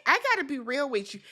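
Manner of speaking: normal talking voice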